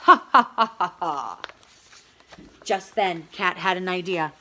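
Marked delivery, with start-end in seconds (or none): 0.1-1.4 loud villain cackle; hammed-up wicked laughter; evil laugh. Wolf laughing over the boiling pot, evil cackle. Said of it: "Wolf laughed".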